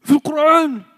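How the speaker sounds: high, loud outraged protest; indignant shout. Retold or imitated. Moroccan friend protesting that his name is Arabic, loud. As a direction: shout it back, offended and insistent. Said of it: "You say".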